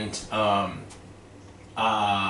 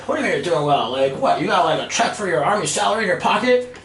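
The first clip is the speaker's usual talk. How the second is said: incredulous challenge; 8 semitones higher, 4 dB louder than usual. Retold or imitated. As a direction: boss scoffing in disbelief, pitched up. Retold boss challenges his claim.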